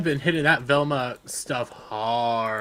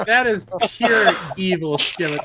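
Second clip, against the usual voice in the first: laughing; 7 semitones higher, 7 dB louder than usual. Real, not acted. Reacting to Velma clip.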